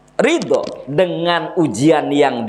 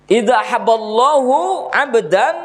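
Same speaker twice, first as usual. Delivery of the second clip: slow, higher chanted Arabic quote; hadith recitation; 7 semitones higher, slower than usual. Acted. Preacher reciting the hadith to the congregation, chanted. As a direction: intone it slowly like scripture.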